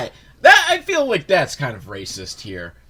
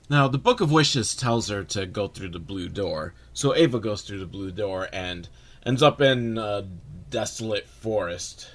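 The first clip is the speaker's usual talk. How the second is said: ordinary talk, nothing marked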